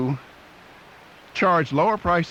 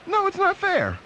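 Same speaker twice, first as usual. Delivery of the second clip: high-pitched mock objection; mock protest. Audience member objecting to unequal prices, mock protest. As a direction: protest in a raised, put-on voice.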